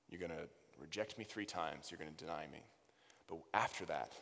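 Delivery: soft, intimate and low. Acted. Jesus speaking intimately to Peter about his denial. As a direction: tell him the truth gently, close in. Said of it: "in an intimate way".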